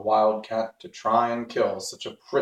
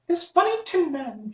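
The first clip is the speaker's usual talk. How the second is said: much higher, tiny gracious squeak; mouse queen. Acted. Mouse queen thanks the Woodman, high tiny voice. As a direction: thank him like a small queen.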